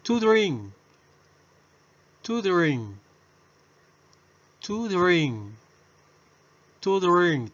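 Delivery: To drink said high and clear with the r; model pronunciation. Shown as a demonstration. Teacher modelling To drink for repetition, high and clear. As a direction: drill To drink crisply. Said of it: "Pronunciación".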